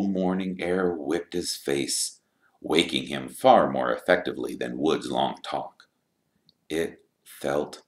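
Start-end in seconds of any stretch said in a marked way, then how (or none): none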